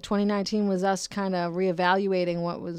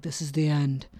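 a hint of a doom-laden movie announcer; mock-ominous end line, lightly voiced; 3 semitones lower than usual. Shown as a demonstration. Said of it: "I don't wanna be ominous and be like".

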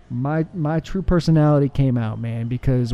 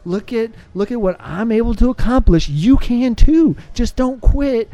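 higher, emphatic call building as it goes; rousing encouragement; 6 semitones higher than usual. Retold or imitated. Guest voices his message to the world, higher rousing appeal. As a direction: urge them on, pitch lifted.

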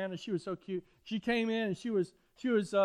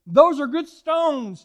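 loud, slow, higher proclamation; preaching emphasis; 8 semitones higher, 14 dB louder, much slower than usual. Real, not acted. Preacher proclaiming to the congregation, loud and slow. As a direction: drive the point home.

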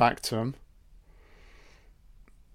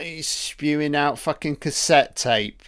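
usual speaking voice

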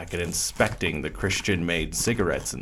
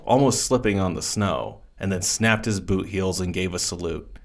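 plain reading voice

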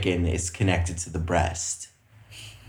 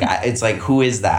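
ordinary talk, nothing marked

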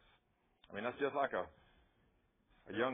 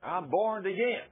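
loud, higher triumphant claim; bold declaration; 8 semitones higher, 7 dB louder than usual. Pretend. Believer declares salvation to the devil, loud and triumphant. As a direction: declare it defiantly. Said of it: "The Word of God says".

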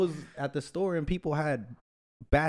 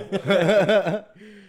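loud laugh; 8 semitones higher, 7 dB louder than usual. Real, not acted. Cohost laughing after telling listeners don't lie. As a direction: burst of laughter.

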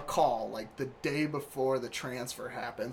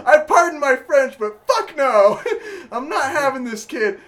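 loud, high, laughing outrage; re-enacted rant, breaking into laughter; shouted refusal; 11 semitones higher, 13 dB louder than usual. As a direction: refuse at the top of your voice. Continuing his yelled refusal on phone.

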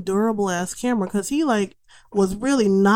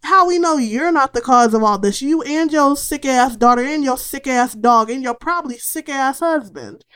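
higher, louder imitation of an indignant tenant firing back; mock furious retort; 5 semitones higher, 5 dB louder than usual. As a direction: snap back at the mother, playing up the outrage. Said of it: "he's like".

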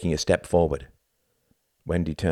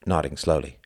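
ordinary delivery, nothing marked

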